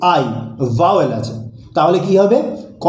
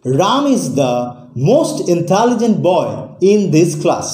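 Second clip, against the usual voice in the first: slow example reading; much slower than usual. Shown as a demonstration. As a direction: read the example sentence very slowly, word by word. Teacher reads example sentence slowly. (খুব ধীরে, স্পষ্ট করে উদাহরণ পড়া)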